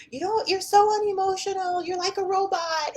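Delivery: high, mocking put-down voice; mock accusing voice. Mocking someone who calls you unemotional, high and loud. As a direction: mimic people calling you cold, up high and loud.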